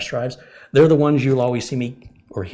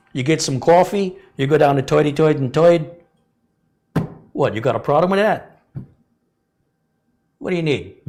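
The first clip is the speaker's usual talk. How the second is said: put-on louder, higher New York tough-guy accent; hammed-up New York street accent, louder and higher; 5 semitones higher, 4 dB louder than usual. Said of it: "what I would sound like if I were in a northeastern accent, New York area".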